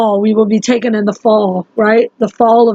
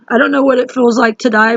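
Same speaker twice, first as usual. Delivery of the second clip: usual speaking voice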